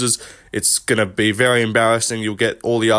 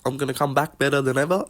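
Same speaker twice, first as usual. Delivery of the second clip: Kai Kara France impression; 3 semitones higher, 4 dB quieter than usual. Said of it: "I can't really do a Kai Kara France impression".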